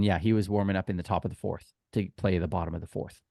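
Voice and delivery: ordinary talk, nothing marked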